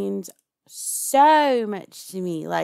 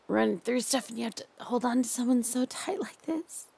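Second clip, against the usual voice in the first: hushed, doting captor who won't let go; 5 semitones higher than usual. Acted. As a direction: lull your captive with creepy tenderness. Holds a soft captor roleplay voice.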